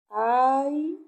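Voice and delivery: "aire" as a long rising aaii, pause, re; split into syllables. Shown as a demonstration. Teacher dividing a word for pupils, "aire" in two long syllables. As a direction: stretch the "ai" of "aire" loudly and detach "re".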